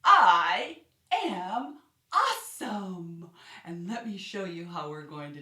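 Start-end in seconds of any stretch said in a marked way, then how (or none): 0.0-3.3 loud, drawn-out cheer of self praise; shouted affirmation with a long am; excited I am Awesome. Shown as a demonstration. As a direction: proclaim it proudly to the kids. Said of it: "I would like for you to say".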